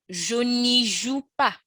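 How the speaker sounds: slow, careful French model; slow teacher demo; slow model line. Shown as a demonstration. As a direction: say it slowly for learners. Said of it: "you'd have".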